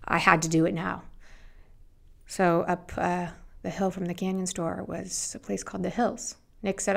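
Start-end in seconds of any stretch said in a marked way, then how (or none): none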